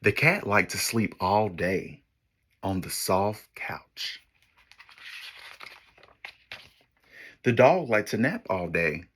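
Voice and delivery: put-on lively storybook read for kids; story narration. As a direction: read it like a picture book to children. Reads picture book to class.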